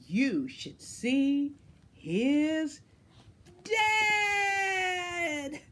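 slow build, then the last word leaps high and loud; excited reveal. A narrator's reading. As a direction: draw it out, then shout the punchline. Teasing build-up to the dad reveal.

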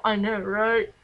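hammed-up "I know, right?" like a joking uncle; uncle's funny voice. Uncle joking with niece on the phone, goofy funny voice. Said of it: "in a funny voice on purpose".

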